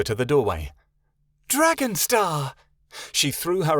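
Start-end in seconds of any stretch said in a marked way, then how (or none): 1.5-2.5 high, drawn-out delighted greeting; joyful cry. A narrator's reading. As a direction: hail an old friend with joy.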